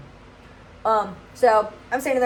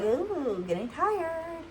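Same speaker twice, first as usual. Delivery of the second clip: drowsy fading; 7 semitones higher, 9 dB quieter, much slower than usual. Retold or imitated. Drifting off under sedation.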